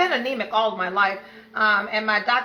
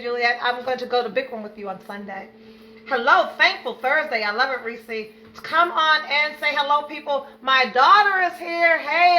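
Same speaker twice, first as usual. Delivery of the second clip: ordinary talk, nothing marked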